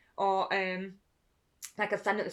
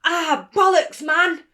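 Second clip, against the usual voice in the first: high, very loud yell; full-voiced imitated shout; shouted catchphrase; 10 semitones higher, 17 dB louder, much slower than usual. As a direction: belt it out like a swearing parrot. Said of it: "and I shouted".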